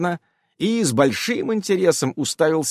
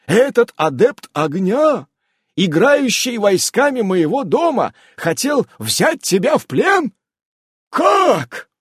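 high, loud, slow incredulous question; rising to a shrill gasp of disbelief; shocked disbelief; 10 semitones higher, 5 dB louder, slower than usual. Acted. Keeper aghast at the threat of capture, high and loud.

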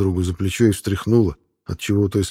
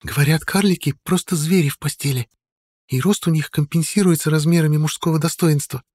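high female voice, lightly hushed; woman's voice; 9 semitones higher than usual. A narrator's reading. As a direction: gossip in a half-voice. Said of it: "полголоса сказала женщина".

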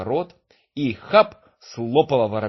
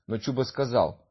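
ordinary delivery

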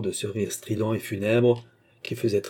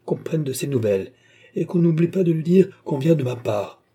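loud, hurried commands; urgent orders; 5 semitones higher, 5 dB louder than usual. A narrator's reading. Old man ordering servants in alarm, loud. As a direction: bark orders to the household.

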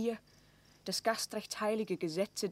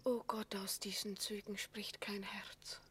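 much softer, a whispered aside; bitter whispered aside; 8 dB quieter than usual. Acted. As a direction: despair under the breath. Maria's bitter aside at queen.

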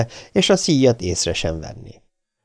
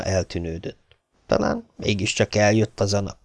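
ordinary delivery, nothing marked

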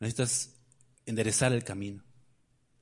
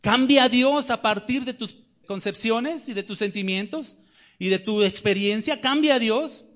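raised, ringing pulpit voice; fiery preaching; 7 semitones higher, 4 dB louder than usual. Acted. Preacher challenging the congregation, raised and ringing.

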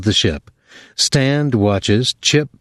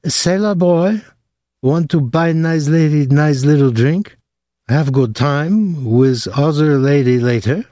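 put-on higher, coaxing bar-girl voice, slowing at the end; bar girl's come-on; 5 semitones higher than usual. A narrator's reading.

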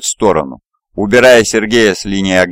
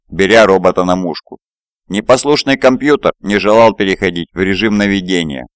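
usual speaking voice

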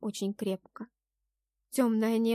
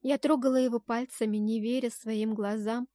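usual speaking voice

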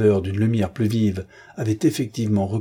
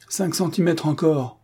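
commanding; 7 semitones higher than usual. A narrator's reading. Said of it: "commanda le colonel Faki".

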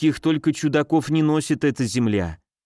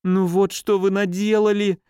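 high-pitched, plaintive reproach; trembling lament; 6 semitones higher than usual. A narrator's reading. Nurse blames the lackey, trembling high lament. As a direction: wail it in distress, voice raised high. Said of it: "дрожащим голосом запричитала госпожа Умбер".

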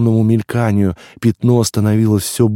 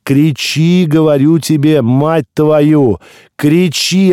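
loud, much higher furious shout; angry yelling; 7 semitones higher, 4 dB louder, slower than usual. A narrator's reading. Stranger yells at the newborn to cry, furious roar. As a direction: roar at him to scream. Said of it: "орал на меня незнакомец".

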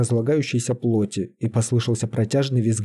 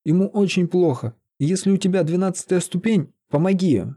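higher-pitched, acted entreaty; imploring; 7 semitones higher than usual. A narrator's reading. Sorcerer begs Лиза to heal the mage, imploring. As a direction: plead urgently for help. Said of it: "взмолился чародей".

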